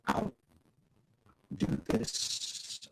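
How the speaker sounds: ordinary talk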